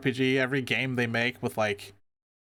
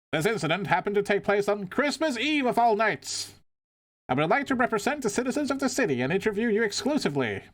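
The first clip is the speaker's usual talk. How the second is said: high, eager TV reporter; news reporter voice; 8 semitones higher than usual. Acted. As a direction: play the pushy live reporter. Reporter voice continues.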